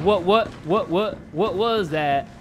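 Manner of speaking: slow, mocking replay of a startled stutter; imitating own startled stammer. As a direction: mimic your own spooked stammer, dragged out. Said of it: "Why did I say it like that?".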